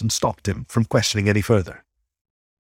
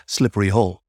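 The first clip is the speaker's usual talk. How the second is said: usual speaking voice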